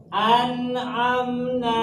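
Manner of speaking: slow, high recited "an'amna", no nasal hum; clear n. Shown as a demonstration. Teacher showing nun meeting ain, clearly recited. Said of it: "Bacanya jelas".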